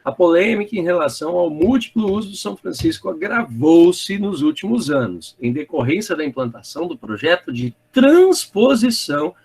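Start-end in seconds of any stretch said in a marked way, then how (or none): none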